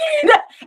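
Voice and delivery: high loud burst of laughter; loud shrieking laugh. Real, not acted.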